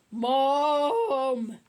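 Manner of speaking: loud shrill call for mum; little brother screaming for mum. A narrator's reading. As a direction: scream for mum at full volume. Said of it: "screamed Peter".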